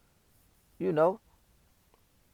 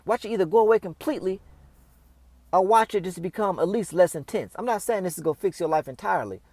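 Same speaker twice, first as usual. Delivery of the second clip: usual speaking voice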